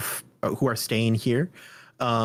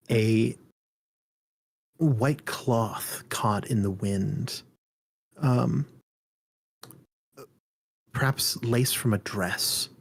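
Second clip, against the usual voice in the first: slow, hushed scene setting; hushed suspenseful narration. Acted. DM sets an eerie scene.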